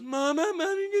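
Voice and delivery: put-on high little-kid wail for mom; mock crying child. Little boy calling after his dragged-off mother, high childish wail. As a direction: whimper it like a toddler reaching out. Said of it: "little boy just goes".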